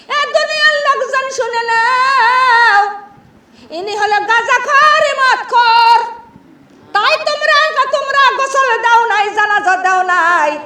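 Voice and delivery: high, loud chanted announcement to a crowd; imam's public proclamation. Retold or imitated. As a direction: proclaim it loudly, stretching the phrase endings. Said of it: "ইমাম সাহেব তখন সকলের কাছে ঘোষণা করতেছে".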